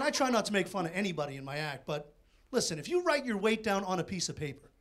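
ordinary talk, nothing marked

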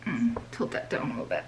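hushed careful instruction; whispering. Real, not acted. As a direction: whisper so as not to disturb the pour. Guiding a careful pour.

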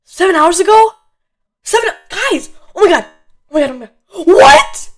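shrieking in disbelief; high, loud shock; freaking out. Real, not acted. Shocked at the new item.

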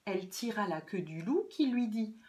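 ordinary delivery

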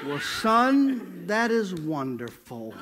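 slow, sinking motherly reply; imitated mother's measured approval; unexcited mother. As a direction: praise him slowly, with little excitement. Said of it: "she goes like this".

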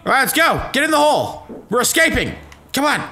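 high, loud urging; rally them to escape; high urgent escape calls. Real, not acted.

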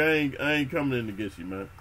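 ordinary talk, nothing marked

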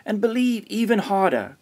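high, sustained exclamation; storybook calling, pitched high throughout; excited cry. A narrator's reading. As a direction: cry it out like a vow. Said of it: "cried Grandfather".

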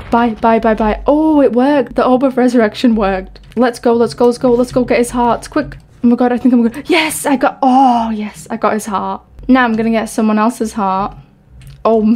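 ordinary talk

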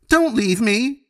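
put-on terrified child clinging and wailing; desperate crying plea. A narrator's reading. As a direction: cry it out as the fear peaks.

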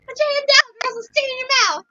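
Isabel voice. Acted. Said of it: "And Isabel's voice".

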